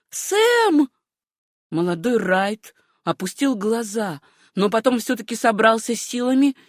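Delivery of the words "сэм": very high, shocked call; gasped cry. A narrator's reading. Horrified young woman calls her lover, high gasp. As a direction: gasp the name high in dismay. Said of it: "ахнула она".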